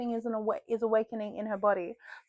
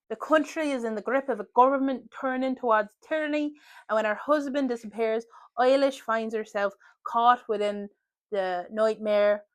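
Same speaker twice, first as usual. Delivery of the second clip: put-on old Irish man accent; 3 dB louder than usual. Shown as a demonstration. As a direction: read it like an old Irish countryman. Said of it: "when I try to do an Irish accent".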